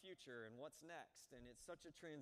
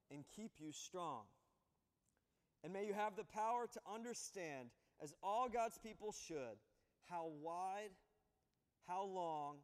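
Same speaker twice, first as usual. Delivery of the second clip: raised, emphatic scripture reading; 3 semitones higher, 5 dB louder than usual. Acted. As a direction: read the verses like a proclamation. Reads scripture passage.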